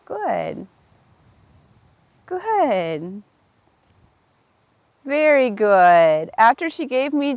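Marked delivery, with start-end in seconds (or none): 0.1-3.2 reward her gently; soft praise. Real, not acted. Praising horse as she backs. 5.0-6.3 slow soothing praise. Real, not acted. Praising horse as she backs.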